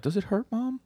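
very high, small put-on daughter voice; high-pitched mock child's voice; little girl voice. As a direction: imitate a child asking her mother. Daughter asks her mom.

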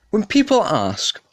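ordinary delivery

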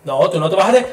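normal talking voice

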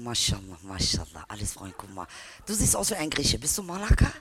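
ordinary delivery

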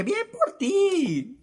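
very high, very slow strained bien por ti; choked-up falsetto congratulation; fake congrats. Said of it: "Le dije".

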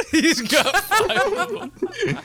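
laughing. Real, not acted. Echoing a friend's punchline.

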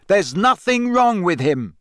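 high, heated outburst; shouting. A narrator's reading. Harry defending Hagrid to Rita, shouting. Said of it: "Harry shouted".

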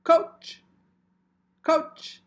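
loud high shout. Acted. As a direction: yell to grab his attention. Interrupting to get coach's attention.

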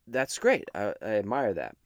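ordinary delivery

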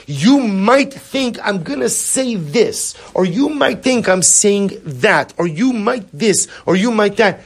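high, slow, drawn-out mock speech; exaggerated sing-song. Shown as a demonstration. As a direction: stretch each phrase up high to mock guarded talk. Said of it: "if I talk like this".

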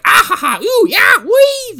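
high, loud gleeful whoops; giddy leprechaun. Retold or imitated. Leprechaun bopping through the weeds, high loud whoops. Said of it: "He's like".

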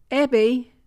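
loud, high call across the room; mother calling her daughter to the phone, loud. A narrator's reading. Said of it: "she called out to Abby".

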